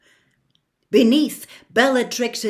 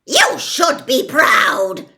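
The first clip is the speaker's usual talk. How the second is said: louder, hard and cold; ruthless Bellatrix; 5 semitones higher, 9 dB louder, slower than usual. A narrator's reading. Bellatrix scorns Narcissa, ruthless and loud. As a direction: scorn her sister's grief. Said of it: "said Bellatrix ruthlessly".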